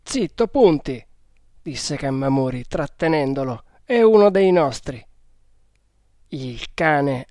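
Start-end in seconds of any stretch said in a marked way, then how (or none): 0.0-1.0 higher-pitched order to a dog; quieting a dog with a firm word; sharp hushing command. A narrator's reading. Cammamuri restraining his snarling dog, firm command. 3.9-5.0 higher, louder insistence; emphatic command. A narrator's reading. Cammamuri holding back his dog, loud and insistent.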